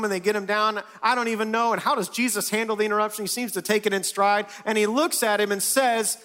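high, animated storytelling; bursting with wonder at the story; excited. Acted. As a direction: paint the wild scene with energy. Excited retelling of roof scene.

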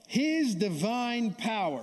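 slow, solemn reading voice; lightly preached scripture. Acted. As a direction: read it out slowly with weight. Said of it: "Listen to this stuff".

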